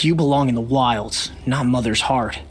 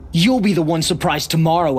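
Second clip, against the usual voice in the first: usual speaking voice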